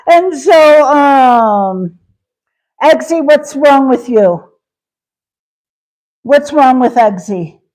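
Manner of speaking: ordinary talk, nothing marked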